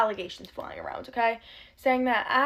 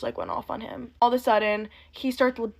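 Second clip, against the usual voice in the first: ordinary talk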